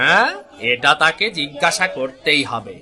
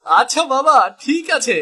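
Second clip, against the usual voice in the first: put-on young son voice; 8 semitones higher than usual. Son answers his father.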